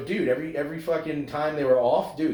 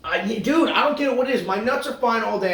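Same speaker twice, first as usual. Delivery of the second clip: exasperated; 9 semitones higher, 4 dB louder than usual. Real, not acted. Exasperated about itching.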